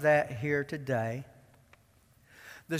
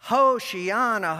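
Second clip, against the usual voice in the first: ordinary talk, nothing marked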